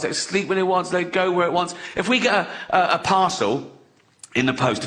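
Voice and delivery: normal talking voice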